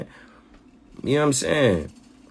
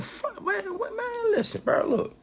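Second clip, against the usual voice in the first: frustrated outburst; 7 dB quieter than usual. Real, not acted. Sudden frustrated outburst mid rant.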